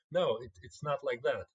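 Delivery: usual speaking voice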